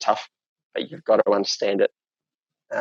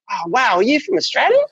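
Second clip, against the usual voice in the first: high, mock-surprised customer; 11 semitones higher than usual. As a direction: imitate a clueless punter, pitched up. Customers mistaking him for Australian.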